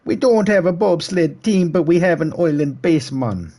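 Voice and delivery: put-on high, loud joke Jamaican accent; bad Jamaican accent. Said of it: "making fun of the Jamaican accent".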